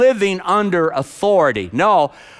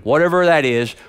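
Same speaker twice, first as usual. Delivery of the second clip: ordinary delivery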